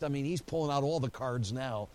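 usual speaking voice

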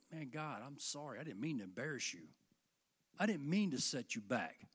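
soft, low, sorry murmur to God; a lightly voiced, hushed confession; hushed apology. Believer apologizing to God after speaking wrongly, hushed.

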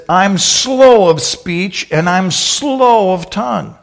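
high, slow self-pitying; slow whining plea. Acted. Moses pleading.

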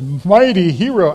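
mock-heroic greeting with a touch of sarcasm; almost sarcastic. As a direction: greet him as if half teasing. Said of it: "it's almost sarcastic".

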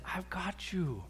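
soft, slow and comforting; tender reassurance. Acted. Jesus to sinking Peter after grabbing him, tenderly reassuring. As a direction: reassure gently, taking your time. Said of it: "I think it went something like this".

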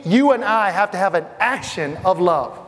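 ordinary delivery

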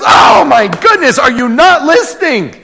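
a beaver fed up with the question; exasperated shout. Acted. Mister Beaver scolds Susie, exasperated shout. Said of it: "He goes".

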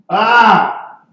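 mock startled scream. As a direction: yelp as if startled by floating beings. Acting out startled shepherds.

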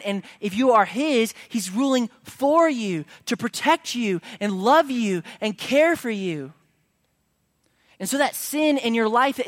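rising pulpit fervour; fervent preaching. Acted. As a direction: preach it out to the whole room. Preaching at full force.

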